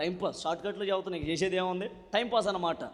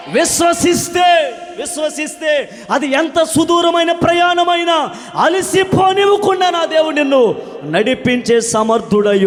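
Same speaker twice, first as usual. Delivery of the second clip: loud, high, ringing preacher's cry; fervent revival preaching, loud and lifted; full-force preaching; 7 semitones higher, 12 dB louder, slower than usual. Acted. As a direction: preach it out to the whole hall.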